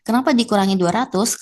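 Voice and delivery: usual speaking voice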